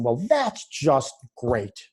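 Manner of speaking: drawn-out sarcasm on "that's just great"; sarcastic. Shown as a demonstration. Coach giving an example of sarcasm, sarcastic. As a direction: stretch "that's" and "great" slowly, meaning the opposite. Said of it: "or, or sarcasm".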